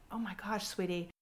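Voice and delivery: a hushed, tender oh my gosh; soft sympathy. Retold or imitated. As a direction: soothe her softly. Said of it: "looked at her and went".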